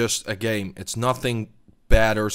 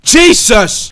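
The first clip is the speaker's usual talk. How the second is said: much higher, louder startled yell; startled shout; 10 semitones higher, 13 dB louder than usual. Real, not acted. Gamer hit by a surprise attack, startled yell. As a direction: burst out in shock. Sudden shock.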